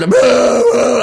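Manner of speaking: mock crowd booing. Comic mimics the punk crowd booing him, loud mock boos. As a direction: boo him off the stage. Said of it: "They're, like, booing".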